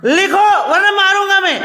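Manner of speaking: high-pitched scolding order with a threat; demonstrated anger. Shown as a demonstration. Teacher showing pupils how to get angry, acted scolding. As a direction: act the cross teacher, pitch jumping up. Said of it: "मैं कैसे गुस्से करता हूँ".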